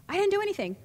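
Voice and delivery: high-pitched defensive protest; protesting innocence. Retold or imitated. Acting her protesting younger self.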